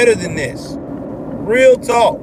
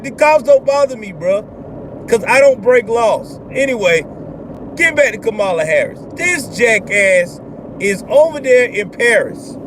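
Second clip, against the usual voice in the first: ordinary talk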